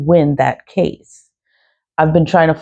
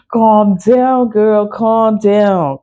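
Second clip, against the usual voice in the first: higher-pitched self-coaxing; talking herself down; 5 semitones higher, much slower than usual. Real, not acted. Woman telling herself to calm down after a rant, higher and coaxing. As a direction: coax yourself to settle, lifting the pitch.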